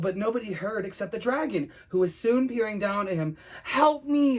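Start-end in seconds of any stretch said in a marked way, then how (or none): 3.6-4.4 high, loud plea; pleading call for help; begging. Acted. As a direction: beg urgently for rescue. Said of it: "begged Jake".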